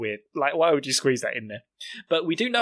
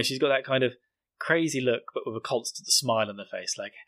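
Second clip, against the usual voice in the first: ordinary talk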